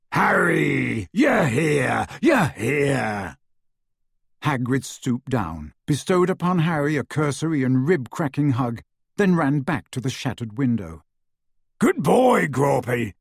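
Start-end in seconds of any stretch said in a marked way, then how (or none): 0.1-3.4 big, excited giant's voice; overjoyed greeting, calling out; Hagrid's voice. A narrator's reading. Hagrid lands in the corridor and greets Harry, excited giant voice. 11.8-13.1 bellowed. A narrator's reading. Hagrid calls through the broken window to Grawpy, bellowing. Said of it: "he bellowed".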